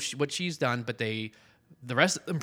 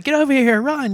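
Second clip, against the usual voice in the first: urgent shout; 14 semitones higher, 8 dB louder than usual. Acted. Shaggy calling urgently.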